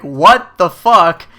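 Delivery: loud, slow, drawn-out what the fuck; outraged disbelief. Retold or imitated. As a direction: let the outrage out slowly. Server walks away ignoring him.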